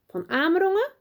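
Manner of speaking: high, amazed question; can't believe who's at the door; astonished gasp of a name. Acted. Teacher recognising the famous professor, astonished.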